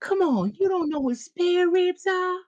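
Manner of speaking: very high, long-drawn plea; desperate begging. Retold or imitated. Mimicking a desperate restaurant customer from a movie, desperate begging. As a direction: wail it like a begging movie character. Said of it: "there's some desperation to it".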